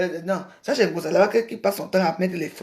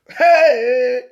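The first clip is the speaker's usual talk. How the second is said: loud hey; 4 semitones higher, 6 dB louder than usual. Real, not acted.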